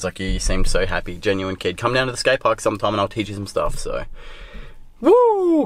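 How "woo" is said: high, loud whoop of excitement; excited whoop. Real, not acted. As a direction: cheer yourself on before the session. Hyped before skating session.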